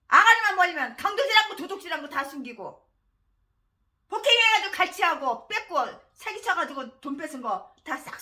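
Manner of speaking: loud, raging insults hurled at a pastor; enraged shouting rant; furious tirade. Real, not acted. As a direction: spit out each insult with real fury. Preacher turns to furious tirade.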